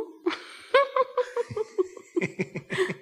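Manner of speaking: bright, long laugh. Real, not acted. Secretary laughing at the name Schreck. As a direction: burst out laughing at the pun.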